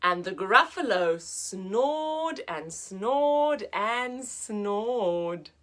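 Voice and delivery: slow, hushed picture-book reading; soft slow storyteller voice; slow story narration. A narrator's reading. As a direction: read it slowly, like a bedtime story. Reads the story aloud.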